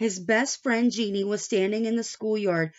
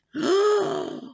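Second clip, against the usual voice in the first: gasp; 11 semitones higher than usual. Real, not acted. Reader reacts to red claws.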